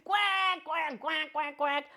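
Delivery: high, cartoon duck quacking; duck quacks. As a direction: quack like a silly puppet duck.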